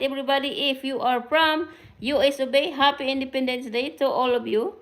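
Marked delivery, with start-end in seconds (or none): none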